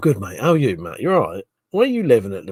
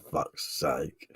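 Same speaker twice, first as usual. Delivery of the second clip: soft laughing whisper; whispered exasperation; 8 semitones lower, 10 dB quieter than usual. Real, not acted. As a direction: breathe it under your breath. Whispered aside after laughing.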